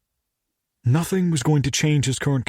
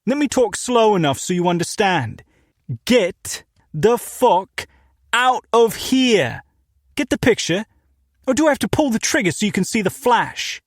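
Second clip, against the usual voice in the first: loud, higher British shopkeeper barking at a stranger; thick British accent, shouted through the door; angry British shop owner yelling; 8 semitones higher, 3 dB louder than usual. Acted. Armed British owner threatening.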